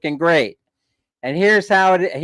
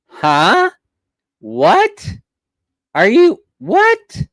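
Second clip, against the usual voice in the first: a shocked customer to the bank clerk, rising in disbelief; incredulous what; 9 semitones higher, much slower than usual. Retold or imitated. Retold baffled protest, climbing high. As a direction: pitch leaps up in disbelief.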